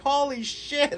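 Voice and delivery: very high-pitched awestruck cry; amazed exclamation. Retold or imitated. Teller watches sparks fly like movies, amazed exclamation.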